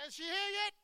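high, loud mock shout; impatient yelling. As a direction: yell it out as a comic impatient searcher. Acting impatient drivers blocking traffic.

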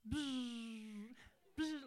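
loud buzzing clipper noise; forceful razor buzz; fast clipper buzz. Retold or imitated. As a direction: mimic hair clippers racing through. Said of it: "like, really fast".

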